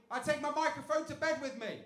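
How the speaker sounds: usual speaking voice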